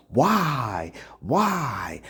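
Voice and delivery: whispered complaining why; mock whining whisper. Preacher imitating his people's whispered complaint. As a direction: whimper the question. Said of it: "Why is this happening to us?".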